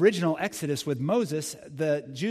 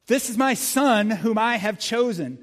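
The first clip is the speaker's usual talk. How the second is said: higher, louder and slow, proclaimed; booming voice of God; 6 semitones higher, 6 dB louder than usual. Shown as a demonstration. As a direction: thunder the verse from the cloud. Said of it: "repeated louder".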